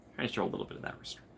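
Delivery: fast unvoiced whisper. Real, not acted. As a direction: whisper it quickly to yourself. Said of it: "I'm trying to show restraint".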